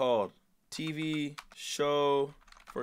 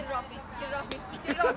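high, rising panicked get it off me; rising fear, high pitched; panicked pleading; 12 semitones higher, much faster than usual. Real, not acted. Raccoon climbing on him.

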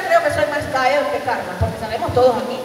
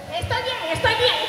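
higher, softer, strained; lightly voiced flustered man; 9 semitones higher, 4 dB quieter than usual. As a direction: insist he's fine, pitched up. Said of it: "y le dice". (voz aguda y contenida)

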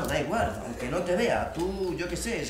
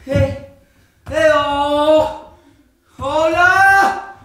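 much higher, shouting hello; calling out; 9 semitones higher, 15 dB louder, much slower than usual. Acted. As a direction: call out desperately into the void. Calling out to a disconnected partner.

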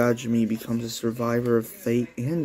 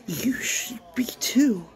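high eerie whisper; creepy whisper; 11 semitones higher than usual. Acted. Psycho whispering to Takomi, creepy.

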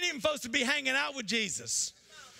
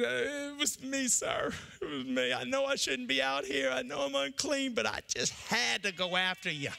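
trembling woman; 3 semitones lower than usual. Acted. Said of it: "The Bible says she was trembling".

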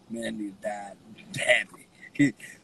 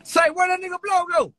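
high, loud call across the room; loud high call to a friend; 7 dB louder than usual. Real, not acted. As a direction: shout it out to find him. Calling out to a friend.

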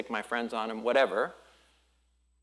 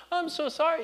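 apologetic visitor; 14 semitones higher than usual. Retold or imitated. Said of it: "they're always".

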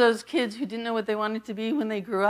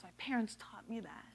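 soft unvoiced whisper of gratitude; a real whispered confession; 14 dB quieter than usual. Real, not acted. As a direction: drop to a hushed breathy whisper. Heartfelt aside after family story.

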